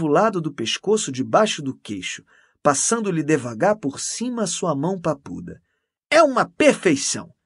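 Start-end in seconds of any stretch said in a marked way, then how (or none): 6.1-7.3 high, loud admiration; raving praise. A narrator's reading. Canon raving to Mendes about her, enthusiastic. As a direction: rave about it, pitched up.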